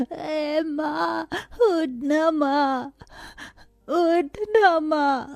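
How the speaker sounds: slow, tearful begging; little child crying for mother; crying plea. Acted. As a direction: plead through tears, drawn out. Child begs dead mother to wake.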